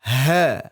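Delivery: "Ha" breathed from the base of the throat. Shown as a demonstration. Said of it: "Bedakan ha dengan ha".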